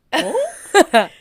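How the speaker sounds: high, loud baffled yelp. Retold or imitated. Dancer answering a strange girl, acted bafflement. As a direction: blurt it out, acting confused.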